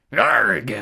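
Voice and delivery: loud bellowing arg; pirate bellow. A narrator's reading. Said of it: "bellowed the big man".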